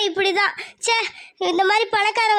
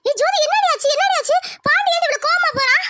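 alarmed mother; 5 semitones higher than usual. Acted. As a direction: call out in alarm, pitched up.

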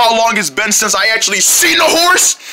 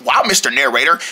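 ordinary delivery

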